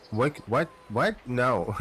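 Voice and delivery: ordinary talk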